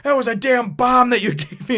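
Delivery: hammed-up angry outburst with a laugh; mock yell. Komatsu yelling at Toriko over the bomb, mock angry. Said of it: "he starts yelling at Toriko".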